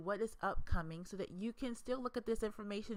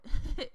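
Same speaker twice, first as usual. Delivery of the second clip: quick, loud, higher burst of laughter; short laugh; 8 semitones higher, 9 dB louder than usual. Real, not acted. Presenter after getting tongue-tied, a short laugh. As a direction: laugh off your own slip.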